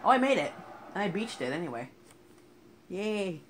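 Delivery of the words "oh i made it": high, loud burst of triumph; excited cheer. Real, not acted. Gamer landing a jump, excited cheer. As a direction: yelp of joy at landing the jump.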